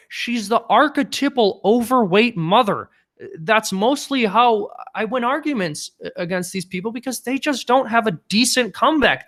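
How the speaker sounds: mock-professorial pontificating, hamming it up; hacky Jordan Peterson impression. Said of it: "I can do my hacky Jordan Peterson impression".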